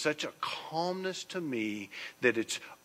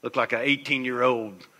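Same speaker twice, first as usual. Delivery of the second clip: mock boastful guy; 7 dB louder than usual. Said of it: "guys are like".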